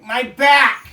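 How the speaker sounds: high, loud comic wail; mock pained yell. Dad watching backflips, hammed-up pained shout. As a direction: cry out as if the back just went. Acts himself hurt at gymnastics.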